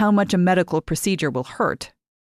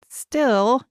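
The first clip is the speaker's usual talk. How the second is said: a hint of doubt, higher girl's voice; hesitant, unconvinced; doubtful; 7 semitones higher than usual. A narrator's reading. Mary hesitating over Lise's plan, lightly doubtful. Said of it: "Mary says doubtfully".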